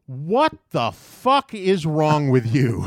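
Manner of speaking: slow, loud, incredulous scolding; angry outburst. Retold or imitated. Customer confronting the skateboarding kid, angry outburst. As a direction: hit each word hard, outraged.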